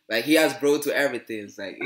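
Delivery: ordinary talk, nothing marked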